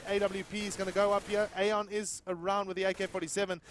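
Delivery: loud, high, building to a shout; hyped play-by-play. Real, not acted. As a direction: call the clutch at full hype. Clutch round live play-by-play.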